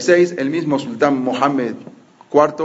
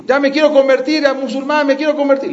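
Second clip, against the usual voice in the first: feigned urgent plea; 9 semitones higher than usual. Retold or imitated. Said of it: "empezó a gritar".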